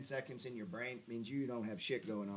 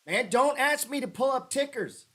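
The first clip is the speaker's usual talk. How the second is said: mock scolding preacher; 7 semitones higher, 3 dB louder than usual. Pretend preacher scolding the chat.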